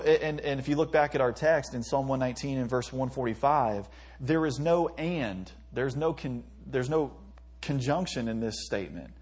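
ordinary delivery

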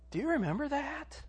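usual speaking voice

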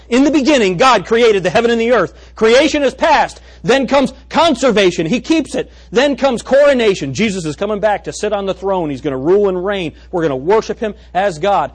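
loud, high, ringing sermon build; preaching at full force. Acted. Preacher thundering the pattern to his congregation, loud and ringing. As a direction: proclaim it to the whole church.

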